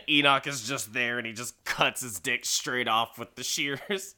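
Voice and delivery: ordinary talk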